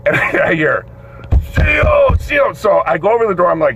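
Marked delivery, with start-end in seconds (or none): 1.5-2.5 high-pitched yell for the guard; imitated prisoner's insistent call; inmate calling out. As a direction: holler it from the cell. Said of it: "this guy is screaming".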